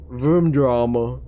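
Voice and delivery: slow, deliberate Filipino-accented "Vroom drama". Shown as a demonstration. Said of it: "my Filipino accent is showing hard".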